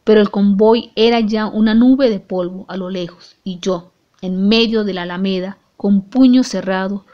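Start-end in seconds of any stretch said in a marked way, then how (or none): none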